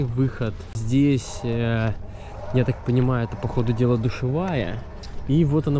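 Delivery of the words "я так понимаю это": ordinary delivery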